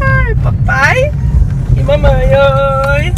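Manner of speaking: voz sacudida, esticando o ai; em estrada esburacada. Real, not acted. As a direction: fala chacoalhando no caminho. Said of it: "tá tremendo tudo". (voz trêmula com o carro balançando)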